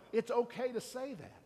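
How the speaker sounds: ordinary delivery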